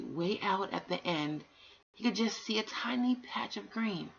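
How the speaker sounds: usual speaking voice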